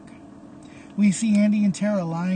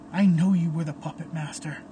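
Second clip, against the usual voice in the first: ordinary delivery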